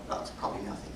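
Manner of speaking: soft near-whisper to Chad; murmuring, trying not to be noticed; hushed aside. Retold or imitated. To friend Chad about the cockroach, whispered.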